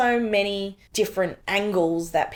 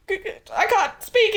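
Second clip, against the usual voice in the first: retold crying; 4 dB louder, much slower than usual. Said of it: "And just crying".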